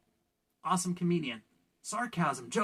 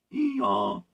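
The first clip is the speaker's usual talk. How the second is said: mock donkey call; 9 semitones higher, 7 dB louder than usual. Donkey joke punchline.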